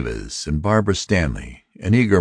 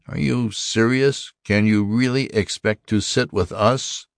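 put-on incredulous old robot; 7 semitones higher than usual. A narrator's reading. Ancient robot objects to the human.

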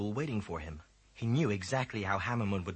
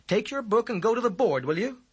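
high, firm teacher calling a pupil; a teacher pulling a daydreamer back; teacher's voice; 11 semitones higher, 8 dB louder than usual. A narrator's reading. Teacher calls a distracted boy to attention, firm and high.

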